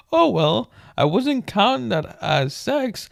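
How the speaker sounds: high, loud mock excuse; whiny high excuse; evasive witness voice.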